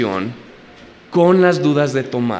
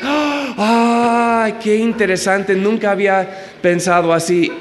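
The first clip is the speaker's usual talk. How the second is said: crédulo ingenuo; 7 semitones higher than usual. Retold or imitated. Imita a crédulos ante falsa enseñanza.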